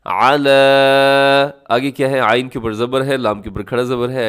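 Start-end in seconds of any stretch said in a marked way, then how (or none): 0.1-1.6 अला with the लाम vowel drawn out, slow; अला with a standing fatha; recited long la. Shown as a demonstration. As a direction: stretch the la of अला. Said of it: "लाम को आपने थोड़ा सा लंबा कर देना है".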